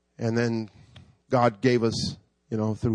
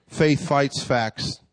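each word slow and weighted; slow emphatic; much slower than usual. Acted. Preacher stating the sermon's theme, slow and weighted. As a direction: land each word slowly.

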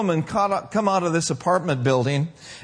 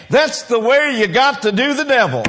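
louder, higher exclamation; hollered out; 6 semitones higher, 6 dB louder than usual. Retold or imitated. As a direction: holler it out on impulse at the street corner. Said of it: "hollered out loud saying".

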